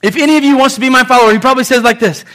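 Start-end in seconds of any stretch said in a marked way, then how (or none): 0.0-1.3 high, loud and fast, all fired up; fired-up call. Shown as a demonstration. Preacher shows Jesus calling the crowd, fired up. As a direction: call it out with fire. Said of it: "Go on".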